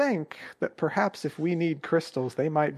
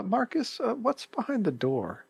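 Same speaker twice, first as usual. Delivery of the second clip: slightly higher, hesitant question; tentative in-character question; 8 semitones higher, slower than usual. Acted. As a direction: ease into an awkward inquiry. Player asks innkeeper in character.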